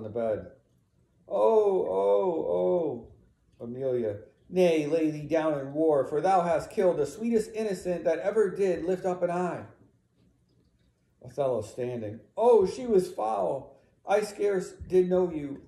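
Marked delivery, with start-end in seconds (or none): 1.3-3.6 high, loud drawn-out "oh"s; acted wailing cries of grief; anguished wail. Othello falls on the bed wailing. 4.5-9.7 raised, impassioned rebuke; condemning. Acted. Emilia condemns the killer. 12.4-15.6 high, loud, slow declamation; slow, heavy cry to justify the deed; anguished self-justification. Acted. Othello justifies the killing.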